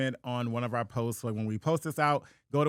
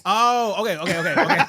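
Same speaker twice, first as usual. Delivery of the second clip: loud okays broken by laughter; laughing realization; 7 semitones higher, 9 dB louder than usual. Real, not acted. Finally getting the Trump joke, loud laughing okays.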